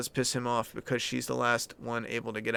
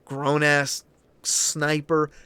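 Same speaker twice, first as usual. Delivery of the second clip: ordinary talk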